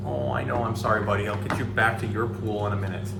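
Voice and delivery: ordinary delivery, nothing marked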